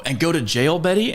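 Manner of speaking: indignant townsman. Retold or imitated. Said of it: "I stand up and go".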